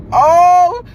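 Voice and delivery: loud, drawn-out dismay; retold horrified wail. Reacting to the boy's rejection, loud drawn-out horror. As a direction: wail it slowly in mock horror.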